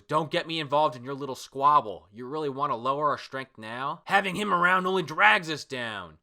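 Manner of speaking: stern manga character telling someone off; annoyed refusal. Acted. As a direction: build to an irritated protest. Acting Drake's annoyed refusal.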